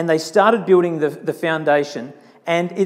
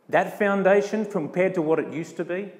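usual speaking voice

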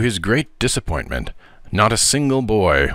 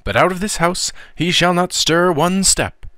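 to herself; 10 semitones higher than usual. A narrator's reading. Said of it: "soliloquized Miss Meadow".

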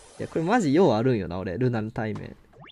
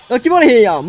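frustrated; 7 semitones higher, 10 dB louder than usual. Real, not acted. Frustrated game moment.

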